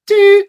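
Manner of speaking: a high, loud mock beep of a hung-up line; playful high doot sound effect; call-ending beep. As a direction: toss off a high little doot like a line going dead.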